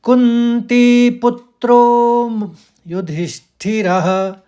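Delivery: कुंती पुत्रो slow, पुत then त्रो; stressed syllables. Shown as a demonstration. Teacher demonstrates the second quarter's stresses. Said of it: "फिर सुनिए".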